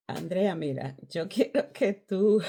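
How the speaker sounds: a hint of God's stern command; voice of God calling. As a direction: boom it out like God summoning her.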